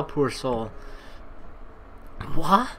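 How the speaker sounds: normal talking voice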